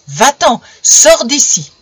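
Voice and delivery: father yelling at his son to get out; furious shout. A narrator's reading. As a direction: bark the order at full force. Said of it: "et lui cria".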